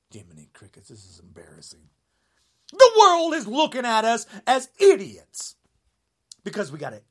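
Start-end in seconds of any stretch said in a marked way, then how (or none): 0.1-1.9 much softer, lower, breathy whisper; hushed embarrassed aside under the breath. Real, not acted. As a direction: whisper it to yourself in dismay. Muttered aside in the rant.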